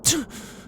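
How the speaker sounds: a high, airless panicky titter; a laugh that is really fear; breathless nervous laugh. Acted. Ghost realizing the demon could drag them to hell, panicky laugh. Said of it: "We're in full panic mode".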